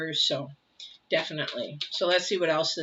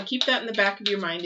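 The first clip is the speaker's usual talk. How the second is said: ordinary talk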